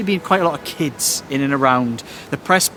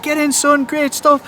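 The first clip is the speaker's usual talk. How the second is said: put-on high, thrilled cheer for the kid; pumped-up praise shouted from the stands; excited cheer; 10 semitones higher, slower than usual. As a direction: cheer him on like a proud fan. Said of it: "I was like".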